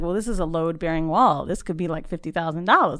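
a tradesman breaking bad news, voiced lightly; lightly voiced contractor.

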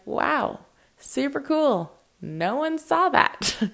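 high, slow mock cheer; sarcastic. Sarcastic mock cheer at a post nobody saw. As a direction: fake delight that drips sarcasm. Sarcastic reaction to low views.

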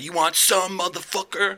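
high-pitched imitated challenge; mock taunting. Drunk dude challenging him at the bar, mock taunt.

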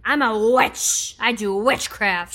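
slow, drawn-out witchy declaration; drunk witch scream. Retold or imitated. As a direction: proclaim it like a tipsy witch. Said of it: "Gianni said I was screaming".

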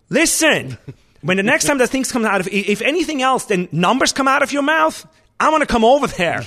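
yelling at the fish cook to give numbers; furious kitchen shout. Retold or imitated. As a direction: lose it at the cook and threaten him. Retold threat to the cook.

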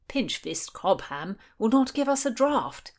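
high, plaintive complaint; aggrieved thirsty whine; whining. A narrator's reading. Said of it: "whined William".

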